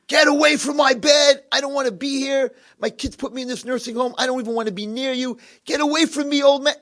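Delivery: put-on high-pitched cranky old man's outburst; cranky screaming. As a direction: play a miserable old man screaming him away. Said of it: "He's screaming at him".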